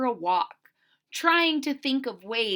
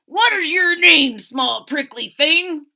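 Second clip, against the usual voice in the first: loud, higher, mocking sneer; unkind rhino; 4 semitones higher, 9 dB louder than usual. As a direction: sneer down at a tiny creature. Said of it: "asked the rhinoceros unkindly".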